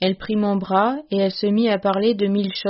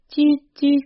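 titi pitched high and louder; husband calling his dog ever higher as night falls; plaintive high call; 6 semitones higher, 4 dB louder than usual. A narrator's reading. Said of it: "titi lamentable, plus aigu".

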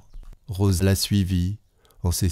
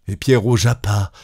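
ordinary delivery

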